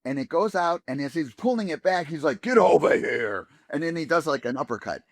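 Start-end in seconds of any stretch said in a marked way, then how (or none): 2.4-3.5 slow, loud gruff growl; gruff Kano voice. Retold or imitated. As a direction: bark it like a tough fighter. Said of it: "he did it in the gruff Kano voice".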